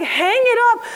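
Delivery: high, laughing instruction; laughing dad. Retold or imitated. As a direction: say it through a laugh. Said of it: "He's like".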